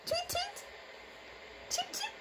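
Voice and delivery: very high, soft tweet tweet; chick peeps. Pretend. Mom showing toddler how chicks peep, very high and soft. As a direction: peep it tiny like a baby chick.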